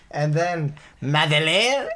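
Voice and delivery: a silly mock French accent; bad French accent. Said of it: "really bad French accent version".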